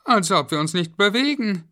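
put-on high, loud child voice; squeaky, anxious kid's chatter; worried children. A narrator's reading. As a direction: wonder aloud like a kid stuck in a lift. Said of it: "glaubte Florian".

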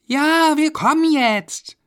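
put-on very high, loud child voice; drawn-out squeaky kids' reply; children answering. A narrator's reading. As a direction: pipe up like children calling back. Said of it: "Die Kinder antworteten".

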